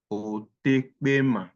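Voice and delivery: Otekpema stretched slowly; slow pronunciation demo. Shown as a demonstration. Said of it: "It's pronounced as o".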